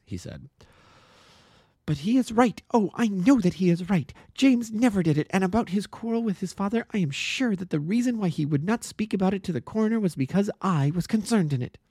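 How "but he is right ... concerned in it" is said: put-on high, urgent young woman defending her friend; earnest young woman. A narrator's reading. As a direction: plead with fierce conviction. Miss Turner insists.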